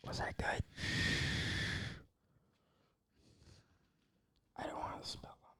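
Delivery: soft unvoiced ASMR whisper. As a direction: whisper close to the mic. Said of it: "Nerd A S M R".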